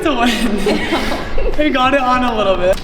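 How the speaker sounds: laughing through the words, loud; giggly and loud after the challenge. Real, not acted.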